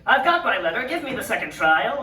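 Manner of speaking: loud, high heroic announcement; triumphant demand. Retold or imitated. Patient returns with the letter, loudly triumphant. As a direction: declare it boldly to the troll.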